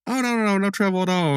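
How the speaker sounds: high, sugary put-on voice; lightly voiced high cheerful girls; sweet girls' reply. Girls brush off the rivals.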